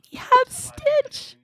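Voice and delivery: high, slow, squealing reveal; gleeful squeal over a favorite character; excited squeal. Real, not acted. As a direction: build it up and squeal the name.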